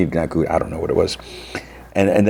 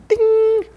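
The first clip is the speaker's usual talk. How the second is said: high, held "ding"; imitated bell ding. Bell interrupts two rivals' quarrel, high imitated ding. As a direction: ring out like a little bell, long and high.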